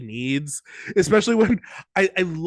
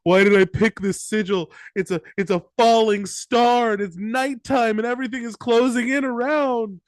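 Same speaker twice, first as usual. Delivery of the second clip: higher-pitched mock panic; acted anxious fretting, pitched up; catastrophizing; 6 semitones higher than usual. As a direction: spiral into worst-case worry, playfully overdone.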